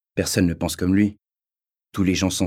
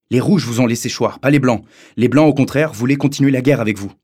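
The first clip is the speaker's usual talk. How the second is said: higher and louder, insistent; heated schoolboy, louder and higher; heated protest; 5 semitones higher, 6 dB louder than usual. A narrator's reading. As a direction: argue back hotly in the schoolyard. Schoolboy defends the Whites heatedly.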